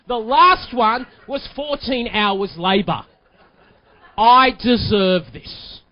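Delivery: building from low to a loud, insistent demand; determined wife. Retold or imitated. Wife closing the sale on her husband, mock indignant. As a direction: press the case harder and harder, slowing at the end. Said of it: "a little bit more determined".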